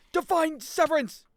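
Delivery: high, loud, slow battle shout; hammed-up special move cry; attack callout. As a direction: yell the move name like an anime hero.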